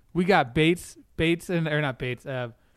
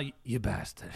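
Downton quote again, low; 6 semitones lower, 8 dB quieter than usual. Retold or imitated. Host repeats the Downton throwback, low and soft. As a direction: lower and softer put-on Downton voice. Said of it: "I'm gonna throw this down".

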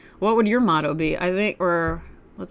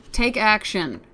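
usual speaking voice

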